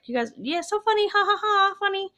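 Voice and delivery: high, fake "ha ha ha" aimed at the ghosts; sarcastic mock laugh. Mocking the ghosts' prank.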